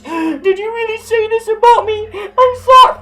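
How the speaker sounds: very high, squeaky mock crying voice; whiny crybaby mockery. Vlogger imitates the rival as a crybaby, squeaky falsetto whine. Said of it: "Don't go crying via messages".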